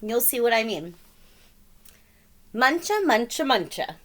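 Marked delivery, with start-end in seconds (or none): 2.5-4.0 chanted munching noises; munching sound. Acted. Reading the bunnies' munching aloud with a chomping chant. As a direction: chomp it out like a bunny.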